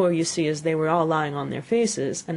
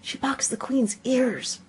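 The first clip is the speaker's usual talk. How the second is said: slow, secretive whisper; hushed rabbit confidence; confidential whisper; 8 semitones higher, slower than usual. A narrator's reading. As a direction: whisper the gossip slowly.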